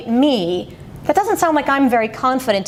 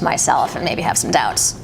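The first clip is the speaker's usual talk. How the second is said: trailing off into fry; 8 semitones lower than usual. Shown as a demonstration. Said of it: "Sounds like maybe I was interested at first".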